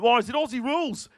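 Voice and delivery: put-on complaint, pitched well up; mock objecting Australians. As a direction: imitate a crowd of pedantic sports fans. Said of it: "They'd all be like".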